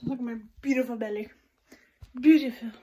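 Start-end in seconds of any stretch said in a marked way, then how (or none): 2.2-2.7 mock proud. Shown as a demonstration. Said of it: "Um, just kidding".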